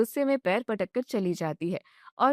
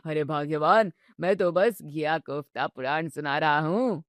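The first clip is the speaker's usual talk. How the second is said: put-on teasing old father-in-law; 6 semitones lower than usual. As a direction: savour every jibe like a mischievous grandfather.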